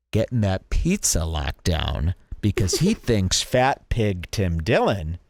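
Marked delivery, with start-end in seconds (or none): none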